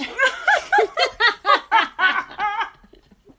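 high, loud burst of laughter; shrieking real laughter; big real laugh. Real, not acted. Laughing at the retold blunder.

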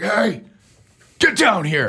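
mock stern dad calling out. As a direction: call a kid down like a stern father. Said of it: "dad voice was".

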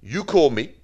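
normal talking voice

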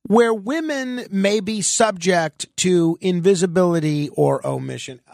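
ordinary talk

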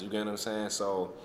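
normal talking voice

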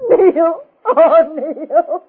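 high sobbing wail for a dead husband; grieving wail. Acted. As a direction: cry the name out in raw grief. Widow wails for murdered husband.

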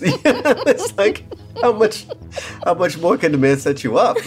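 laughing through the words; giggling as he talks. Real, not acted. Laughing while telling about [name].

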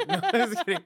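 high, loud laugh through the words. Real, not acted. Laughing after teasing insult.

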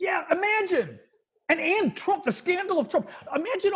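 high-pitched exasperated disbelief; incredulous indignation. Real, not acted. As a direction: jump in high, appalled at the idea. Indignant at imagined scandal.

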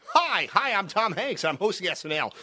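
a sudden Hanks yell on the first hi; Tom Hanks impression. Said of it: "I could hear it in that moment".

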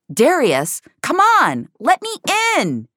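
urgent, high-pitched call. A narrator's reading. Finch locked out of the car calls to Darius, loud urgent plea. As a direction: raise the voice, pleading to be let in. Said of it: "Finch said louder".

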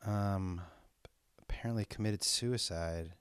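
slow, hushed, halting voice of shock; softly and slowly, in real disbelief; stunned whisper. Real, not acted. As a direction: barely get the words out after bad news.

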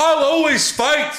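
high, slow, loud wrestler impression; Kevin Owens. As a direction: declare it like a defiant wrestler. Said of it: "tag Kevin Owens in it".

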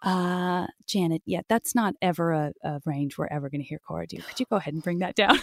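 a hint of a gentle voice director; imitating the director. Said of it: "Andrea Romano would be like".

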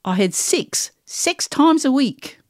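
slow New Zealand vowels; New Zealand accent. Shown as a demonstration. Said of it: "really special one is New Zealand".

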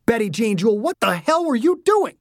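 high-pitched, outraged parental telling-off; angry scolding. A narrator's reading. Angry father to his daughter after her crash, scolding. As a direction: scold the child by full name, rising in outrage. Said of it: "He was too angry".

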